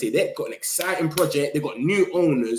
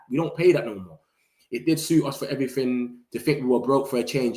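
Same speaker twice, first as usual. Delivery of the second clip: ordinary talk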